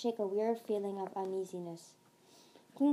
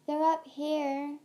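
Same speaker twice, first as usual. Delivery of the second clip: higher, drawn-out call; slow, lifted calling voice; 6 semitones higher, 4 dB louder, slower than usual. A narrator's reading. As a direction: call it up slowly to others. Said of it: "she called again".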